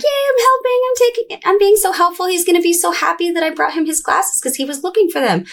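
put-on high, eager little-girl voice; imitating her child self. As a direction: play the proud helpful kid. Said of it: "I was like".

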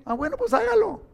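sudden high-pitched quip; mock sarcastic reply. Preacher mocking the easy answer in a high voice. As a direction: jump way up for a cheeky comeback.

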